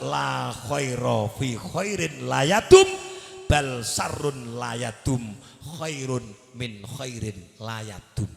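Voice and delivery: slow, measured Arabic maxim; slow recitation. Acted. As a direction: lay out each word slowly.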